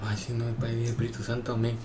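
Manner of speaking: lightly voiced old woman crossing herself; understated muttered prayer; mumbled prayer. Patient blesses herself, praying.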